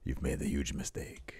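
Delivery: breathy threatening whisper; menacing whisper. Retold or imitated. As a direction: hiss the threat close to the ear. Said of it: "Santos says".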